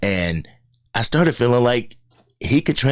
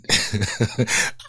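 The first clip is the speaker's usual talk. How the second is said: real, soft chuckle; amused laugh; 4 dB quieter than usual. Real, not acted. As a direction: laugh quietly at what was just heard. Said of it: "couldn't get past it without laughing".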